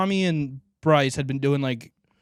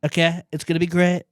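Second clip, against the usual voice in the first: higher, boastful rally-speech voice; Trump impression; 3 semitones higher than usual. Wrapping up the Trump intro, boastful impression. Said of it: "I think that's a pretty good Trump".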